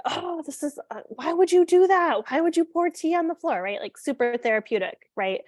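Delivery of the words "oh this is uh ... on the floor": higher and louder, re-enacted frustration at the spilled tea; frustrated louder voice. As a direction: replay snapping at the child over spilled tea. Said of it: "a louder voice and I said".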